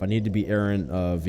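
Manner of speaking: usual speaking voice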